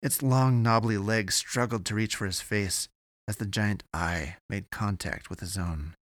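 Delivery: ordinary delivery, nothing marked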